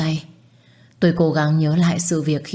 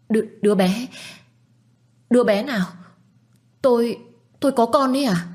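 rising higher, stammering shock; stunned disbelief; 6 semitones higher than usual. Acted. Woman hearing she lost a baby she never knew of, stammering shock. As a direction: voice climbing in disbelief.

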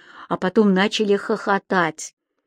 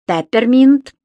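loud, raised, proud announcement; 4 semitones higher, 7 dB louder, slower than usual. A narrator's reading. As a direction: announce your surname with pride. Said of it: "с гордостью ответил Субастик".

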